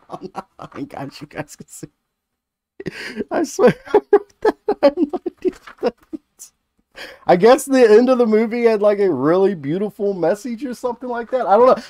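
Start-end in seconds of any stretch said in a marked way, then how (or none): 0.1-6.5 high, giggly embarrassed laugh. Real, not acted. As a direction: laugh it off, mortified. Embarrassed by own notes.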